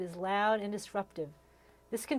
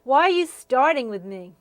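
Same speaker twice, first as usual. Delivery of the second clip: much louder shout; yelling across the room; 3 semitones higher, 12 dB louder than usual. Retold or imitated. Constant screaming and yelling across the room.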